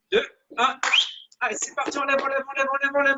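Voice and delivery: ordinary delivery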